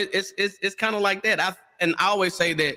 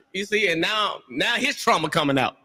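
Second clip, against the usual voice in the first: ordinary delivery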